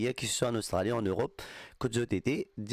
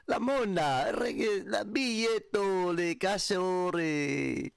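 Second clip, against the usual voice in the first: higher, hammed-up fake Italian; mock Italian; 7 semitones higher, much slower than usual. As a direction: toss off pretend Italian words playfully, slower at the end. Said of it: "on va dire casse en italien".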